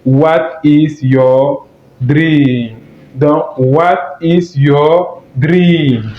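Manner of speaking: very slow what is your dream, every word apart; slow model English. Shown as a demonstration. Teacher models the English question slowly. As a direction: lay it out slowly for learners.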